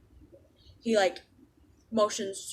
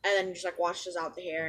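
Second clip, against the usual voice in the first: normal talking voice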